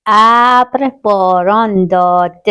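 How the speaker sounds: slow, word by word, each syllable clear; slow reading aloud. Shown as a demonstration. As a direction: read it out slowly for young pupils. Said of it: "روی حرف نشانهٔ د خیلی تاکید داشته باشین".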